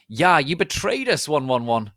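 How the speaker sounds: high, loud accusing shout; angry shout. A narrator's reading. Vampire accusing Draco, angry loud shout. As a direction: hurl the betrayal at him. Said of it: "shooted Vampire angrily".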